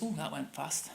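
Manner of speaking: ordinary talk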